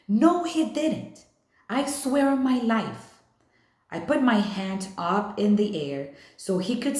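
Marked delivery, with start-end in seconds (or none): 0.1-3.1 high, loud protest; heated denial. A narrator's reading. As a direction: swear it up and down, pleading. Schoolyard quarrel, swearing denial.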